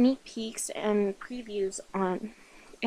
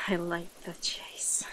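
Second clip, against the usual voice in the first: soft, breathy whispered relish; menacing predator; 4 semitones lower, 8 dB quieter than usual. Acted. Predator alone as the prey runs, menacing whisper. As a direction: savour it in a low-volume whisper.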